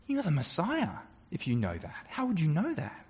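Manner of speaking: dawning wonder, barely above a breath; wide-eyed awe. Acted. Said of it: "his eyes grow wide and he says".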